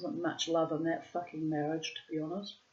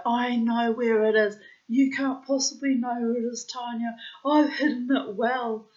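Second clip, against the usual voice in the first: 3 tease back with big confident delivery; 1 playful boasting; 7 semitones higher, 9 dB louder than usual. Retold or imitated. 4 couple bantering over hidden chocolate, loud playful boast.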